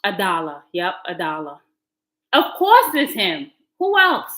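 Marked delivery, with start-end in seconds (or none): 2.3-4.3 exasperated outburst. Real, not acted. Exasperated about the ex.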